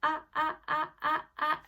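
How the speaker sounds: bravely. Shown as a demonstration. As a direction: punch each sound out boldly. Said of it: "Say it bravely".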